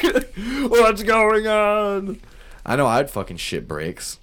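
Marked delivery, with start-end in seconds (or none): 0.0-2.2 high, loud mock panic; hammed-up disbelief; mock shocked worker. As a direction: play a staffer freaking out. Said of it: "They're like".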